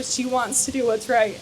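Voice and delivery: ordinary delivery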